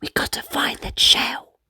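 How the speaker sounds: secret whisper to his brother; hushed conspiratorial whisper. Acted. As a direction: breathe it like a plan nobody should hear. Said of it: "Ocean whispered to Kingston".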